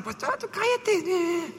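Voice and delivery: high, drawn-out mock shushing; put-on high voice telling someone off; dismissive rebuke. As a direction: imitate a crowd shooing someone away, high and slow. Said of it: "Lo rechazaron".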